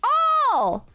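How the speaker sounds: high chirpy phone voice, lightly done; cheery lady. Retold or imitated. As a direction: play a bubbly shop lady.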